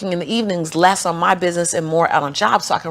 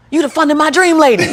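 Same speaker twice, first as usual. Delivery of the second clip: higher, louder, laughing as the delighted teller; excited laughing recognition; 8 semitones higher, 6 dB louder than usual. Retold or imitated. Bank teller laughing as she recognises Lisa. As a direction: blurt it out with a laugh. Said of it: "she's like gasps".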